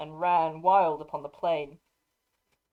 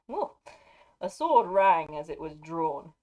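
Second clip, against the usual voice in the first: usual speaking voice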